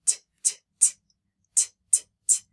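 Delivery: T, t, t as voiceless taps, air only; demonstrating voiceless t; unvoiced t. Shown as a demonstration. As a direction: tap out the t with no voice. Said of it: "is the T sound voiced or unvoiced?".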